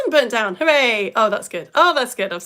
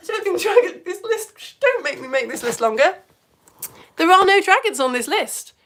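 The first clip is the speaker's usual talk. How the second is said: high, flustered protest growing louder; mock dismay; 9 semitones higher, 6 dB louder than usual. Realising dragons are missing from the list, mock-horrified protest. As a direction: protest as if scandalised by the omission.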